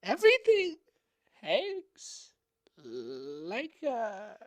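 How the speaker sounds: a lightly hammed-up sage lecturing on balance; mock wizard voice. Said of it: "What is this accent?".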